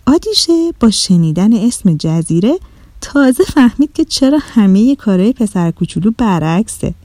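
usual speaking voice

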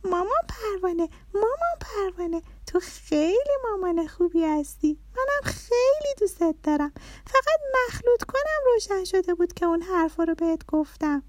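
put-on very high, affectionate child voice; little daughter. A narrator's reading. Little girl apologising to mum in the kitchen, high and loving.

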